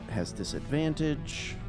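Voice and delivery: usual speaking voice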